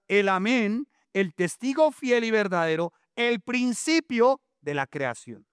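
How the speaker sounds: high, slow declaiming sermon voice; preaching. Acted. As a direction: proclaim it to the congregation. (predicación declamada)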